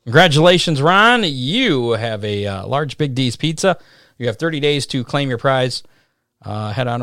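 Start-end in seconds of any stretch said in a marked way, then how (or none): 0.0-5.8 loud, excited prize announcement; hyped giveaway announcement. Real, not acted. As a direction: congratulate the winner with energy. Announcing pizza winner.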